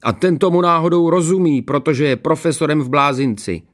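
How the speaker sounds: put-on high, loud boyish boast; taunting schoolboy. A narrator's reading. Pupil citing his expert father, taunting.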